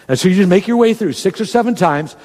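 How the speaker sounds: ordinary delivery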